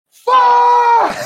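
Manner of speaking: high, loud raging scream; furious shout. Retold or imitated. Player losing a fight, raging shout. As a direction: scream out in total rage. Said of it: "you just hear me go".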